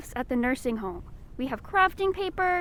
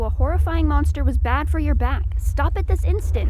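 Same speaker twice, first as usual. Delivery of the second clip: stern scolding; 9 dB louder than usual. Acted. Scolding the shapeshifting Debbie.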